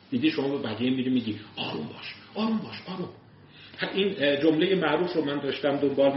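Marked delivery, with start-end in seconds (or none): 1.6-3.1 calm someone down gently; hushed soothing. Retold or imitated. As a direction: softly urge آروم باش, first almost whispered. Telling others to calm down.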